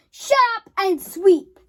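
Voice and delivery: loud, slow barked command; bossy order. Acted. Boss ordering the worker to sweep, loud bark.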